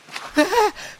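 a sudden high cackle of a laugh; short high amused laugh. Acted. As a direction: burst into a high laugh to himself. Laughs alone after the quarrel.

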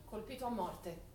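ordinary talk

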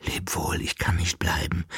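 soft unvoiced farewell; whisper. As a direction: barely voice the goodbye. Abschied der Rose flüsternd. (leiser Abschied, geflüstert)

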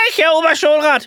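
outshouting. Acted. The impostor outshouts the real teacher before the inspector. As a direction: shout him down. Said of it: "überschreit ihn der Unechte".

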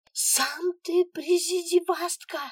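put-on high, slow, loud child yell. A narrator's reading. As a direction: scream it like a dangling kid. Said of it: "орала Алёна".

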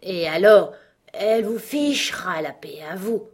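lightly puffed, emphatic frog; a hint of a breathless old frog; panting old frog. A narrator's reading. Frog limps back to its tree, lightly puffed. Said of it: "dit-elle tout haletante".

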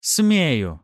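high, loud retort; defiant exclamation. A narrator's reading. Wife defying her husband, exclaiming. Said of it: "воскликнула женщина".

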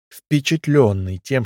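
ordinary delivery

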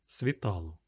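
ordinary delivery, nothing marked

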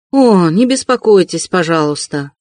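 usual speaking voice

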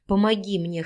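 plain reading voice

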